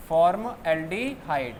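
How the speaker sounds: word stretched syllable by syllable; slow dictation. Real, not acted. Teacher dictating a note to students, slowly and evenly.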